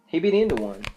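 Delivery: usual speaking voice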